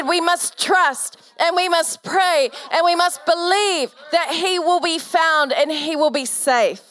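rally her to believe; fervent exhortation. Real, not acted. Urging friend to believe.

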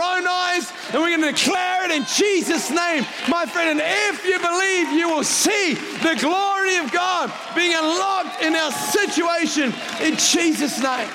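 full-force shouted preaching, high and loud; drawn-out, high revival-preacher shout. Real, not acted. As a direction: declare it at the top of your lungs, slowly.

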